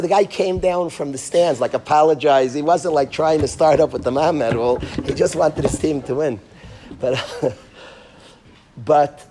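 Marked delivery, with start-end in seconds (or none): none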